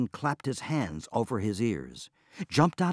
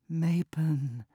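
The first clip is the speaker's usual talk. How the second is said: high, drawn-out plea of the name; a lightly voiced wife begging her husband; wife calling his name in a plea; pleading wife; 7 semitones higher than usual. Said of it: "pleaded his wife".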